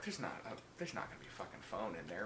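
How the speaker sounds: low, quick, quiet aside; imitating a viewer's hushed doubt; hushed disbelief. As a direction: drop low and rush it under your breath. Voicing viewer's nervous disbelief.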